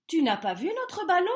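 eager rabbits asking a question. A narrator's reading. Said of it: "demandèrent-ils".

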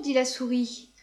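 ordinary delivery

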